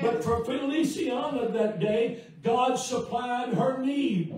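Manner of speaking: loud, impassioned pulpit delivery; full-force preaching. Real, not acted. Preacher testifies to the congregation how God provides, loud fervent preaching. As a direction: preach it out loud, driving toward each amen.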